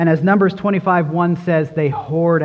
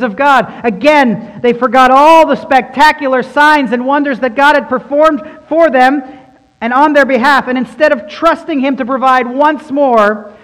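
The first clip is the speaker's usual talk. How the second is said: rising, louder sermon build; preaching at full force; 6 semitones higher, 6 dB louder than usual. Acted. Preacher driving home God's faithfulness, mounting louder and higher. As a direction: preach it harder and harder, climbing.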